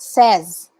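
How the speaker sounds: says said low as "sez"; correct pronunciation demo. Shown as a demonstration. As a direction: say says with a short e and z. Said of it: "so you say it like that".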